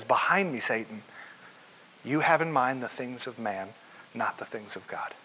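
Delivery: low, hushed and firm, fading away; quiet stern rebuke. Retold or imitated. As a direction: rebuke him softly but with weight. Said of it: "a harsh rebuke of Peter".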